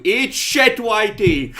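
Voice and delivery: loud angry street yell. Acted. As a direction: scream it at him from a window. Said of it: "They just yelled out at you".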